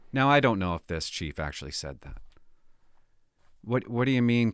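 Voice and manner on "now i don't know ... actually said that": ordinary talk, nothing marked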